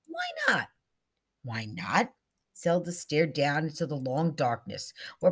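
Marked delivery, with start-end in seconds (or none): 0.1-0.7 very high-pitched, falling appeal; high, insistent question. A narrator's reading. Bella urges Zelda to speak up, high and insistent. 1.4-2.1 lower-pitched question, turning up at the end; low, pondering echo. A narrator's reading. Zelda ponders Bella's idea, echoing it low.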